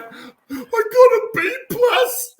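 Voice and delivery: high, slow, laughing through mock tears; laugh-crying. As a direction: blubber it out, half laughing. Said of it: "I burst into tears".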